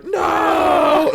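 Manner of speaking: high, loud shout; mock crowd shout. Crowd protesting the sign going back up, loud shout. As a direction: boo like an angry crowd. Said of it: "they're like".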